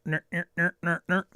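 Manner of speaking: snarky taunt. Pretend. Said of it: "Gotta turn up the snark".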